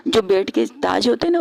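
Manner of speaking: ordinary delivery, nothing marked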